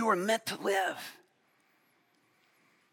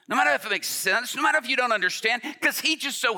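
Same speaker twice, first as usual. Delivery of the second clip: higher, louder and fast; fervent preaching; 7 semitones higher, 5 dB louder than usual. Real, not acted. Preacher to congregation, fervent rising push. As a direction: press the point with rising fervor.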